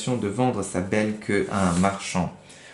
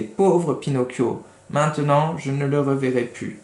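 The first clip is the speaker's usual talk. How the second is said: tearful goat; 4 semitones higher than usual. Retold or imitated. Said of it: "tandis qu'elle pleurait".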